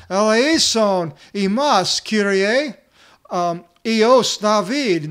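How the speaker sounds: slow, very loud, high desperate plea. Retold or imitated. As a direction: shout it over everyone, stretching each word. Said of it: "all the greater they cried out".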